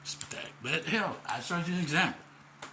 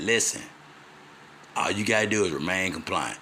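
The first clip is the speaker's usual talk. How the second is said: lower mentor voice; 9 semitones lower than usual. Retold or imitated. Quoting mentor again.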